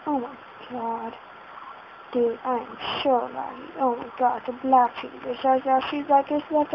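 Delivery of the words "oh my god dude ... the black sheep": ordinary delivery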